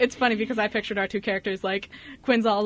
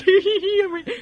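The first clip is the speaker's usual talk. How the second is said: high mischievous giggle; higher, louder mock snicker; sneaky giggle; 8 semitones higher, 3 dB louder than usual. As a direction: giggle like a little prankster. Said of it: "And I'm like".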